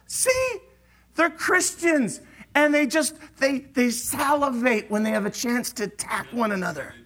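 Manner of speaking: high mocking jeer; mock the church like a cynic; scoffing outsider. Worldly onlooker sneering at Christians, high and mocking. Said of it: "People would like".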